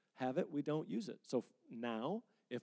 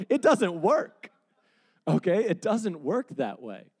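emphatic; 5 semitones higher, 11 dB louder, slower than usual. Real, not acted. Preacher driving the point home.

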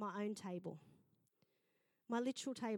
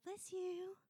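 high sing-out, acted sweetness; good-hearted blessing; 9 semitones higher than usual. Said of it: "turn up with a good heart".

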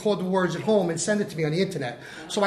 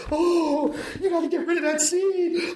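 much higher, whiny, laughing mock wail; mock panicked crew; 9 semitones higher than usual. Mimicking his crew begging to cut the scene, high mock wail. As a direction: whine it like a crew in despair.

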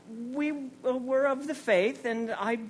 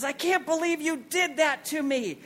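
mother's outrage; 5 semitones higher than usual. Retold or imitated. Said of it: "And she says".

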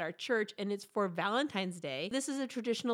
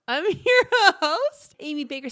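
unsure; 6 semitones higher, 12 dB louder than usual. Real, not acted. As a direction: blurt it as a laughing, high-rising question. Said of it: "Say that with confidence".

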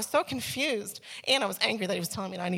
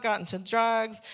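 ordinary delivery

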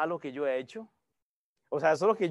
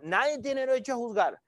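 arrogant sinner; 7 semitones higher than usual. Retold or imitated. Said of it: "usted se ha topado a esos".

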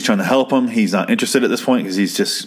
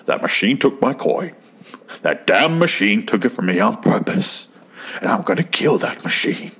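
Daniel Day-Lewis impression. Vlogger mimicking the gambler who sounds like Daniel Day-Lewis, menacing impression. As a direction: lean into a brooding, deliberate old-timey threat. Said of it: "He sounds exactly like Daniel Day-Lewis in There Will Be Blood".

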